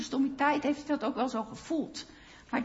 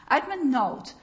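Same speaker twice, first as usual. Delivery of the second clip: normal talking voice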